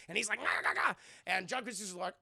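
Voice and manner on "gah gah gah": high, stuttering creature rattle; mock monster clicking; creature noise. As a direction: imitate a monster's chattering call.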